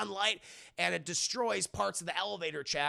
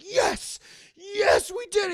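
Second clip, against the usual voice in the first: much higher, louder crying whoop; joyful cheer; 13 semitones higher, 10 dB louder, slower than usual. Retold or imitated. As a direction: celebrate at the top of your lungs. Ned cheering rescue.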